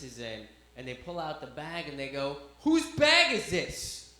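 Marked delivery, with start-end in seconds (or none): 2.6-4.1 high, loud, drawn-out demand; demanding officer. Retold or imitated. Officer holds up the bag found in the car, loud accusing demand. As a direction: call it out slowly, accusing.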